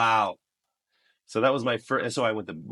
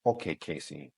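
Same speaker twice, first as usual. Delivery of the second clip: deeper, softer casting director impression; 5 semitones lower, 4 dB quieter than usual. Casting director addressing a newcomer on camera, low imitation. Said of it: "it was more like this".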